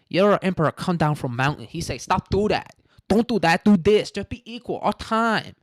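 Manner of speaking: hammed-up broken English Chinese accent, climbing up; mock Chinese accent. Host voices Chinese Taoists telling the emperor story, mock Chinese accent. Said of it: "that's what the Chinese said".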